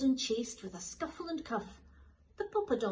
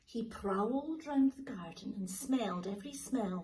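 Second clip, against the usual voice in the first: usual speaking voice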